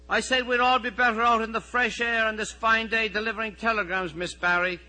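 high, loud recited answer; innocent schoolboy reply. Acted. Messenger boy answering his supervisor, falsely innocent. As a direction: pipe up with put-on politeness.

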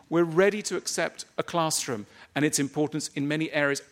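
ordinary talk

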